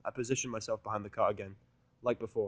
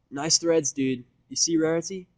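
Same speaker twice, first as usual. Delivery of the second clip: Vinyl's voice; 6 semitones higher, 9 dB louder, slower than usual. Acted. Vinyl teasing Seth's new clothes.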